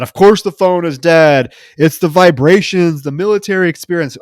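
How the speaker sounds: paranoid yelling. Retold or imitated. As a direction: rant like a conspiracy nut. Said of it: "starts yelling about".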